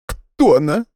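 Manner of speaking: high, strained tearful question; forced out. Acted. Nadya forcing out a hurt question, high strained voice. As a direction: squeeze the words out. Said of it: "выдавила из себя Надя".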